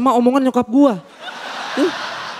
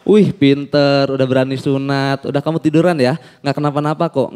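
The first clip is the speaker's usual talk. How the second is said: soothing doctor; 8 semitones lower than usual. Retold or imitated. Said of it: "berusaha menenangkan gua".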